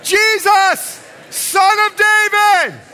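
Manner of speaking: very high, full shout; crying out. Acted. Blind beggar calling Jesus over the crowd, crying out. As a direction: holler it over a noisy crowd, drawing it out. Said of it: "he cries out".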